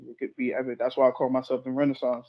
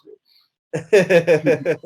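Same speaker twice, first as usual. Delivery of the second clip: loud; 2 semitones higher, 11 dB louder than usual. Acted. Mock shouted praise.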